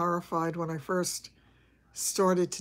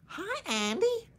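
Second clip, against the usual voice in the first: much higher, squeaky Southern "Hi, Andy"; Gomer Pyle impression; 12 semitones higher than usual. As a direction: push Gomer Pyle's goofy greeting up high. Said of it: "I have to really practice that".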